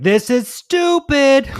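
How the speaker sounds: imitating dad, high and drawn out; mock exasperated dad. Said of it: "my dad being like".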